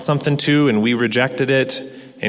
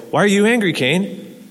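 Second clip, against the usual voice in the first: God asking; 7 semitones higher than usual. Retold or imitated. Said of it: "It's like".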